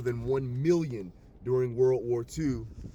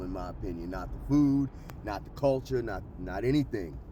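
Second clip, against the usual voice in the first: normal talking voice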